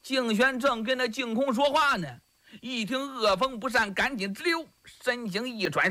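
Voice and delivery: usual speaking voice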